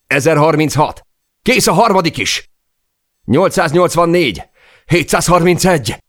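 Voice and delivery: very high, loud yelled countdown; frantic shouting. A narrator's reading. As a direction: shout each call with mounting desperation. Frantic shouted countdown during the fall. (kétségbeesett, magas hangú kiabálás)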